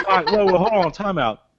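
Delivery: ordinary delivery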